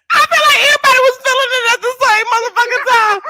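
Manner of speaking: high, fast words through laughter; laughing shout. Real, not acted. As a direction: cry it out laughing. Everyone laughing at once.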